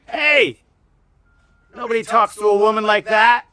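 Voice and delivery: high, loud indignant shout; re-enacted yell. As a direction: yell it out to stop an attacker. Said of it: "and I yelled at him".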